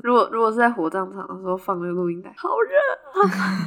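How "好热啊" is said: mock wail. Mock corpse in the cremation furnace.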